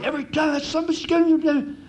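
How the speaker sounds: mock yelling at a jockey riding by; mock bettor yell. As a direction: shout it like a sore loser at the rail. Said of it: "they said".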